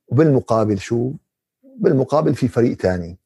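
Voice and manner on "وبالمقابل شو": usual speaking voice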